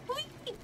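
tiny, very high squeaked "whee"; playful whee. Real, not acted. Player narrating a little drop and go, high squeaky whee. Sounds out the jump.

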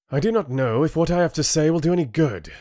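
fast, high, heated outburst; spitting anger. A narrator's reading. Rin to a council that won't listen, spitting. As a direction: spit the words out bitterly. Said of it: "he spat".

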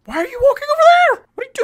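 very high, loud exasperated whine; high-pitched alarm at a wandering dog; alarmed squeal. Real, not acted. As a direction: squeak out the panic.

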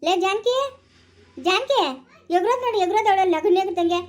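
loud, high summons; calling out. Acted. Calling Janaki over and scolding her, loud.

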